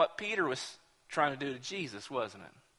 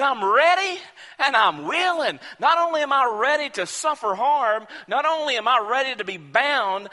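loud, higher declaration, slowing at the end; resolute vow; 6 semitones higher, 7 dB louder than usual. Retold or imitated. Paul telling his friends he is ready to die, resolute.

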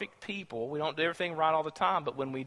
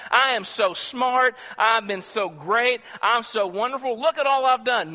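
mock boasting; 7 semitones higher, 6 dB louder than usual. Mocking a boastful believer.

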